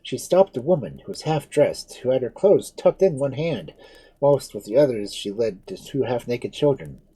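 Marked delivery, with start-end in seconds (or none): none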